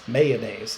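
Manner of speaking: "mayonnaise" with all three syllables, MAY-uh-naze; three-syllable pronunciation. Shown as a demonstration. Teacher repeating the option for class, "mayonnaise" in three syllables. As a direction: sound the middle "uh" in "mayonnaise". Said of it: "Is it two syllables or three syllables?".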